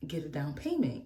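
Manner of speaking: ordinary delivery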